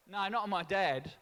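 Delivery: normal talking voice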